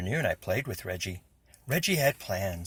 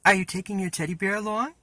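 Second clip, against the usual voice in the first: high, fast mocking question; teasing sister; 10 semitones higher, 6 dB louder, faster than usual. A narrator's reading. Big sister teasing her little brother, mocking and high. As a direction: needle him about the teddy bear.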